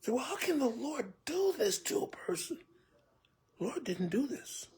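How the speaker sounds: usual speaking voice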